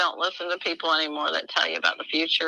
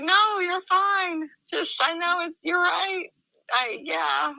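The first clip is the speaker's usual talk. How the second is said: much higher, quivering, rushed; crying reassurance; 12 semitones higher, slower than usual. Retold or imitated. Assuring the man he's fine while crying. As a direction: reassure him through tears. Retelling her reply, crying.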